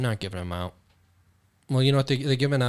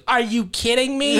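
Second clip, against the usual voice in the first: mock outraged local; 11 semitones higher, 5 dB louder than usual. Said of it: "somebody goes".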